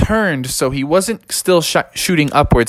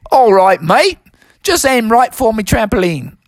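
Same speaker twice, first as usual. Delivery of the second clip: high, hammed-up Aussie kangaroo; put-on Australian accent, higher voice; 8 semitones higher than usual. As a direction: play a laid-back Australian mate, pitched up. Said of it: "your Australian accent sure is awesome".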